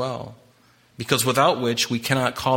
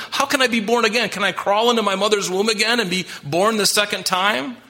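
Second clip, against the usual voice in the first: higher, baffled questioning; a seeker who can't grasp it; puzzled Nicodemus; 7 semitones higher than usual. Acted. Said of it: "That's what Nicodemus is doing".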